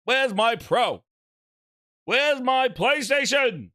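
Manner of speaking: high, drawn-out demand for the console; playful high call for the PlayStation; mock demanding shout. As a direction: whine it out like a spoiled kid.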